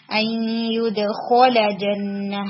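very slow ان یدخل جنہ with a heavy خ; slow it right down, خ thick; teacher models یدخل with full heaviness; heavy letter demo. Shown as a demonstration. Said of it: "اس کے اندر heaviness کو practice کیجئے".

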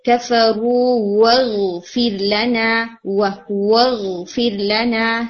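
slow واغفر لنا with a round, lipped واو; rounded waw. Shown as a demonstration. Teacher models the correct waw for the student, slow chant. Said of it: "کہ آپ کا اچھا circle واو کے لیے رہے".

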